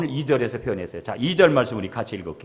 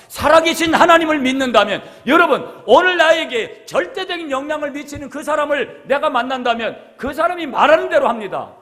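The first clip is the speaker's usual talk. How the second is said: louder, rising preacher's longing; fervent preaching; 13 semitones higher, 9 dB louder than usual. Acted. Pastor preaching to the congregation, fervent and loud.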